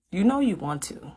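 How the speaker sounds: ordinary talk, nothing marked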